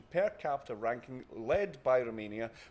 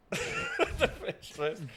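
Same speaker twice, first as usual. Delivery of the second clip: laughing mock; 12 semitones higher, much slower than usual. Mocking friend's line while laughing.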